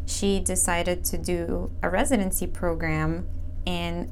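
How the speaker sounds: polished announcer-style intro; smooth host intro voice; podcast intro read. Acted. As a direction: read it like a show opener.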